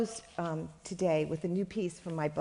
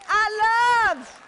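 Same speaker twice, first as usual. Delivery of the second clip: slow, much louder and higher cry of love; joyful shout; 12 semitones higher, 10 dB louder, much slower than usual. Acted. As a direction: belt it out triumphantly to the crowd. Can you say it with me?.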